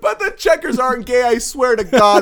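high, squealed comic protest; frantic mock denial. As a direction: protest loudly in a high put-on voice. Acts a panicked denial, riffing.

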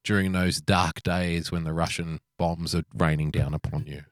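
low, grave wartime-address parody; mock-solemn speech. Mock solemn inspirational address to listeners.

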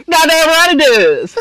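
high, stretched-out movie yell; shouted film quote. Acted. Repeating the Platoon line to friends, high shout. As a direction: draw it out high and loud.

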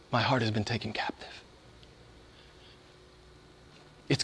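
soft, hushed devotion; quiet, tender worship; whispered song lyric. Retold or imitated. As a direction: murmur it like a private prayer. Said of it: "he says something like".

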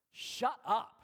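forceful command. Acted. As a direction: silence them with full authority. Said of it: "he said it with the force".

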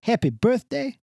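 put-on thick Jersey Italian accent; Italian-American accent. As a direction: play a warm old Italian uncle. Held Italian-American character voice.

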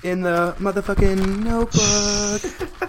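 dramatic. Acted. Mock dramatic aside.

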